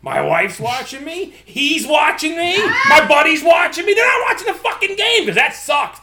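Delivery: high, loud, building mock rant; exasperated rant. Fan being watched during the game, exasperated shouting. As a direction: push pitch and volume up as pressure mounts.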